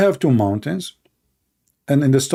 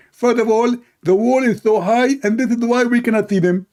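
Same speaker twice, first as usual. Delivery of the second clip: pitched-up, smug know-it-all; mock the opponent's confident rebuttal; high mock debater; 6 semitones higher than usual.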